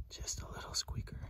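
hushed whisper to himself. Real, not acted. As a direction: whisper it close to the mic. Murmured remark over tiny button.

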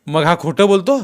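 loud, high-pitched question; sharp challenge. A narrator's reading. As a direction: confront the suspect with the accusation. Policeman challenges the suspect.

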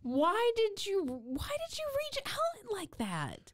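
high, slow, baffled whine; asking why the hero reached out; exasperated disbelief. Real, not acted. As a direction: stretch it out in disbelief. Baffled by the hero's move.

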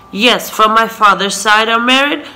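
ordinary talk, nothing marked

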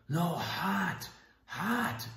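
high, strained imitation of insisting in fear; sheer terror. Father corrects his puzzled daughter, imitated in sheer terror. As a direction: imitate a terrified man struggling to be understood. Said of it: "he's in sheer terror".

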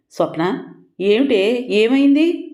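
a frightened mother rising into panic; a sick mother seeing her bloodied daughter, rising alarm. Acted. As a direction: voice climbing high in alarm.

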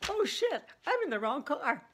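high, loud startled oh shit; sudden alarmed realization. Retold or imitated. Friend realizing she sat in a stranger's car, startled. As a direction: blurt it out as the mistake dawns. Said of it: "instantly she gets, quote".

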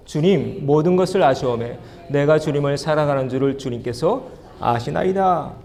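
ordinary talk, nothing marked

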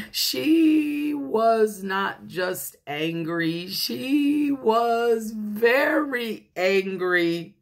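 slow, drawn-out emphasis on "very angry"; slow, ominous storytelling emphasis; emphatic. A narrator's reading. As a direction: stretch each word for dramatic weight. Narrator dwells on the anger.

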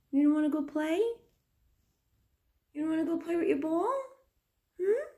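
high, sing-song cooing to a little one. Real, not acted. Mother calling her baby to look at the camera, high cooing. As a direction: coo up high to get her attention.

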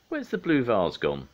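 high, loud puzzled question; surprised. A narrator's reading. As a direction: ask in storybook astonishment. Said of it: "said Dad, surprised".

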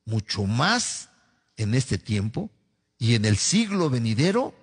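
emphatic scripture reading in short, separated phrases; lectura bíblica. Acted. Reads scripture aloud. (lectura proclamada de la Biblia, frase por frase)

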